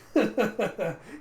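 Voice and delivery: high, loud imitated laughter; put-on guffaw; acted hearty laugh. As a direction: burst out laughing in character. Acts out Radha laughing.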